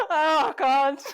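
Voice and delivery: high laughing groan of "oh God"; laugh, then exclaim in mock dread; laughing dismay. Real, not acted.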